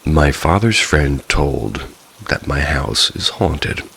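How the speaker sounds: ordinary delivery, nothing marked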